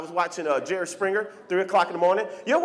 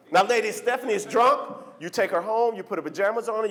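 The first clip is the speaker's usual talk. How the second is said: ordinary delivery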